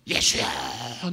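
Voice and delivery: long drawn-out cry; shouting to Jesus. Shown as a demonstration. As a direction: stretch the call out slowly as a shout. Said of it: "예수님에게 소리치세요". (길게 끌며 부르짖는 소리)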